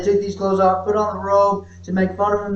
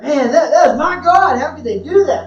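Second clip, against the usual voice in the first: higher and louder, voice near crying; tearful dismay; 8 semitones higher, 8 dB louder than usual. Retold or imitated. People watching the Passion film, near tears and louder. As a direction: plead it like a grieving believer. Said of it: "People are like".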